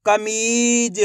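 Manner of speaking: "कमीज" with a long held ee; slow teaching read of "कमीज"; blended, drawn-out word. Shown as a demonstration. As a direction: stretch the middle of "कमीज". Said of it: "तो मिलके बन जाएगा".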